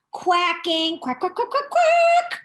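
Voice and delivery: high quacking, rising to a squeak; duck quacking. Acted. Reading to kids, she quacks like an exercising duck. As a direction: quack like a duck doing voice drills.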